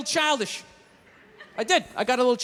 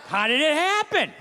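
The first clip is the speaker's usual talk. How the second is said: mock questioning; 4 semitones higher, 8 dB louder, much slower than usual. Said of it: "wouldn't you guys have some questions".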